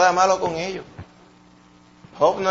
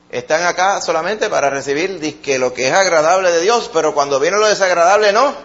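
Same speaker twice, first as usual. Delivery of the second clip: raised, ringing preacher voice; forceful preaching; 4 semitones higher, 4 dB louder than usual. Acted. Pastor challenging the congregation, preaching at full force. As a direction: drive the challenge home to the congregation.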